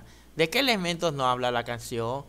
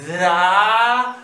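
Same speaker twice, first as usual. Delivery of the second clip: "Dragón" stretched out slowly and louder; "Dragón" loud and slowly drawn out; 3 semitones higher, 8 dB louder than usual. Shown as a demonstration. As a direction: draw out "Dragón" slow and loud, for kids. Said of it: "Lo decimos despacito".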